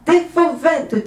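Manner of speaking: put-on high, drawn-out children's correction; loud, high interrupting young ogresses; ogresses' interjection. A narrator's reading. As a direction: pipe it up slowly, high and loud. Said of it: "disent les ogresses".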